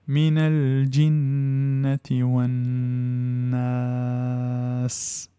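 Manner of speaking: slow chanted recitation; Quranic recitation. Shown as a demonstration. Teacher reciting the verse slowly for students. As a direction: intone Alladhi yuwaswisu fi in long tones.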